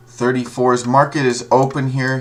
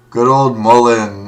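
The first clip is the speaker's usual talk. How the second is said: drawn-out mock cheer; 7 dB louder than usual. Real, not acted. Mock cheer over bad stock.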